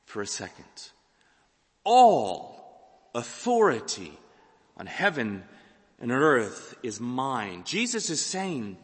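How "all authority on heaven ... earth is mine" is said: slow, weighty. Acted. Repeating the claim slowly.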